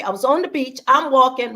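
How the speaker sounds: ordinary talk, nothing marked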